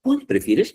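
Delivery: "Cuál" lifted high, asking directly; question intonation. Shown as a demonstration. Teacher repeating the example as a question, questioning tone. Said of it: "Está haciendo una pregunta".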